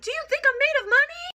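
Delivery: very high mock-tearful protest; about to cry. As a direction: imitate a mother wailing on the verge of tears. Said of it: "Like, about to cry".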